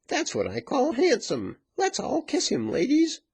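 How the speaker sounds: high-pitched excited woman; rally the women in a high, gleeful voice; high, exuberant approval; delighted exclamation. A narrator's reading. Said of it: "exclaimed another".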